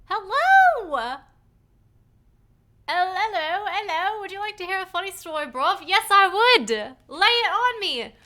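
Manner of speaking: hammed-up British accent, high and loud; a cheery, overdone British greeting; bad British accent. Streamer greeting a character in a bad British accent. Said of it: "I do a horrible, horrible British accent".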